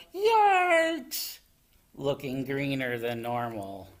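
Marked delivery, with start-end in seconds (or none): 0.1-1.4 high, loud yelp; alarmed yell. A narrator's reading. Froggy on the water slide, high loud yell. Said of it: "Froggy yelled".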